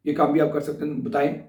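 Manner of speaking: ordinary talk, nothing marked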